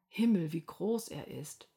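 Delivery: usual speaking voice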